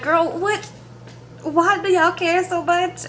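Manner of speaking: high, drawn-out incredulous question; retold disbelief. Retold incredulous reaction to cast.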